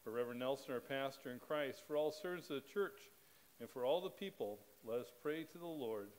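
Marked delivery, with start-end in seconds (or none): none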